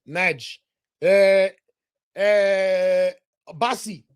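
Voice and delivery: usual speaking voice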